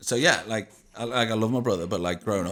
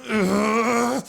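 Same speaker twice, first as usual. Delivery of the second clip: mock grunt; 8 dB louder than usual. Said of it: "I'd be like".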